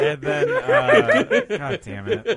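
high squeaky laughter through the words; laughing. Real, not acted. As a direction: lose it completely while talking. Friends cracking up over a joke.

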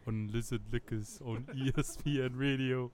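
low, lightly put-on German accent; a hammed-up German leather daddy voice; mock German accent. New radio host, mock German accent. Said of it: "sound like a leather daddy in Germany".

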